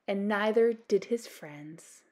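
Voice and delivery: ordinary delivery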